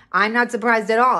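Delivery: usual speaking voice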